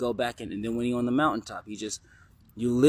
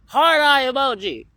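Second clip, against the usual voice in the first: shouted emoji; 13 dB louder than usual. Acted. Reading a student's message aloud, high loud shout. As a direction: blurt it out big and high.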